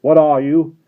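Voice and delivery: loud high demand; guard challenging the stranger; challenging shout. Acted. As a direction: call it out hard.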